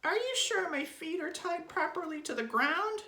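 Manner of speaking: very high, loud squeaky worry; Mrs. Twit's anxious gasp. A narrator's reading. Wife tied to balloons, high anxious gasp. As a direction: squeal the question in alarm. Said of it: "she gasped".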